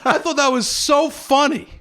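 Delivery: high, loud, giddy excitement; real hilarity. Real, not acted. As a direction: gush about it, still tickled. Teller raving about his joke.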